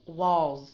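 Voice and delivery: Walls ending in a drawn-out z; long z ending. Shown as a demonstration. Teacher giving Walls for the student to copy, long z. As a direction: model Walls with a stretched z. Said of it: "So try to read it".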